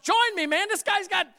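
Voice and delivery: high, loud pleading cop; officer wrestling a shoplifter begs the crowd for help, high and loud. Retold or imitated. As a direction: beg the crowd to pitch in. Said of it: "I'm looking up like".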